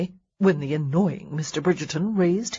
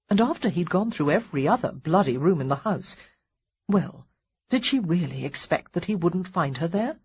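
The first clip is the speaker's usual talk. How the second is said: usual speaking voice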